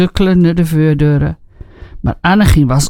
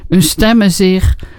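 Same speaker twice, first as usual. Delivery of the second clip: plain reading voice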